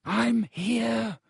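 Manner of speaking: high, distant-sounding call; strained reply through a wall; muffled. A narrator's reading. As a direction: call back as if from behind rock. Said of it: "came Ron's muffled voice".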